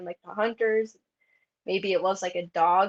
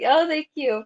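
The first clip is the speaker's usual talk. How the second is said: laughing mock thanks; 6 semitones higher, 8 dB louder than usual.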